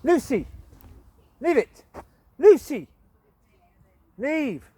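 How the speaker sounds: loud, high call to the dog; firm command to drop it; sharp shout at the dog. Real, not acted. Scolding dog off a bowl.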